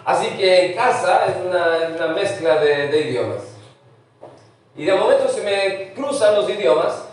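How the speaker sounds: usual speaking voice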